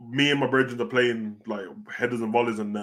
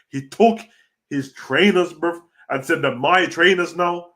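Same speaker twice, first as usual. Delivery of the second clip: higher and louder, slow and taunting; mock wrestler promo; 5 semitones higher, 6 dB louder, slower than usual. Retelling the heel's promo, a hammed-up gloat. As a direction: gloat like a heel stealing shoes.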